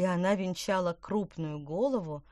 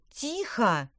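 a higher, louder snapped hush; sharp shout; 6 semitones higher, 5 dB louder than usual. A narrator's reading. The angry witch shouts at her chattering friends to hush. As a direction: cut them off with one sharp word. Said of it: "крикнула Петронелла".

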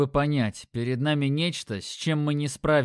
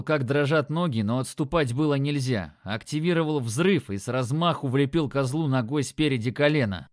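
ordinary delivery, nothing marked

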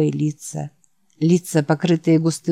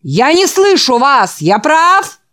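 loud, high call for a louder answer; demanding preacher; 8 semitones higher, 5 dB louder, slower than usual. Acted. The preacher demands the boys answer louder. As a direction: demand a bigger response.

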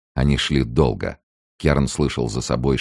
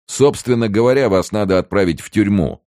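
high, raised official scolding; gendarme's reprimand; 8 semitones higher, 5 dB louder than usual. A narrator's reading. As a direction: lecture the captives loudly. Gendarme lectures the captives.

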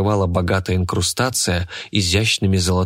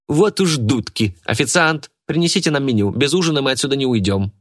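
put-on defiant young woman; 7 semitones higher than usual. A narrator's reading. Polina retorts, high far voice.

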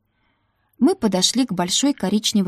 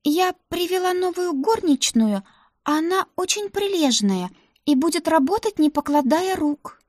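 put-on high eager young maid; Ruta's voice; 9 semitones higher than usual. Ruta vouching to the madam for the new maid, high and eager. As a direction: pitch it up, pleading for the new girl.

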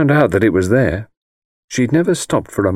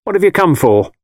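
abrupt, higher-pitched question; 9 semitones higher, 4 dB louder than usual. A narrator's reading. Mother to her visiting child, abrupt. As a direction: ask it suddenly, pitched up. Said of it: "Then she said abruptly".

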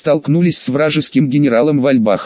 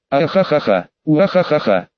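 mustache twirling villain cackle. Acted. Gloating at a castle being blasted.

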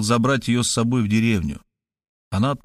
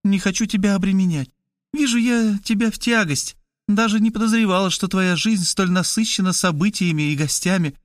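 a higher, lighter woman's voice; Kristina's voice; 9 semitones higher than usual. Acted. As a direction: play the sister, pitched up and urgent. Kristina reproaches brother.

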